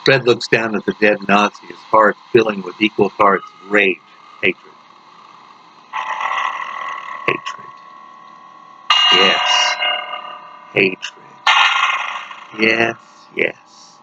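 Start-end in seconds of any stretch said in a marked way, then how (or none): 4.4-13.0 breathy whisper, the second take much softer; whispered hatred. Acted. Inner voice savouring hatred. 13.4-14.0 whispered yes. Acted. Inner voice agreeing darkly.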